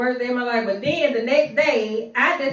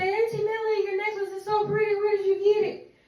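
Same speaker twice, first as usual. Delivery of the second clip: put-on much higher little-girl voice; sweet child asking, pitched way up; niece's voice; 9 semitones higher, 3 dB quieter than usual. Niece admiring auntie's necklace, high little-girl voice. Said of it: "My niece said".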